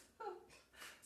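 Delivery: quick high giggle with no voice; a short silent giggle; laughing. Real, not acted. Host laughing at her own onion tears, giggle.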